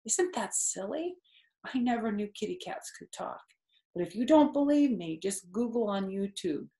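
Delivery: usual speaking voice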